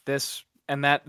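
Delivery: normal talking voice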